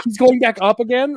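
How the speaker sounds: can't believe he's climbing again; alarmed disbelief. Retold or imitated.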